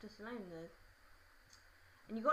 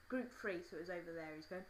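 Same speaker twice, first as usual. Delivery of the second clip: usual speaking voice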